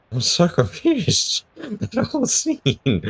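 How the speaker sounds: squeaky, giggling bewilderment; high laughing confusion. Real, not acted. Baffled after the tree scene, high laughing squeak.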